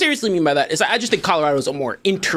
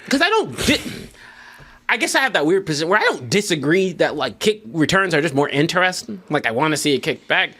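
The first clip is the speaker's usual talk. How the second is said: normal talking voice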